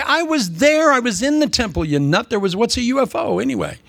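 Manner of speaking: high-pitched, scoffing protest; incredulous rebuttal. Acted. Preacher playing an eyewitness answering a goofy claim, incredulous. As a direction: protest high, as the one who saw it.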